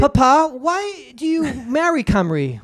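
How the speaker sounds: put-on child asking. Child voice in imagined future.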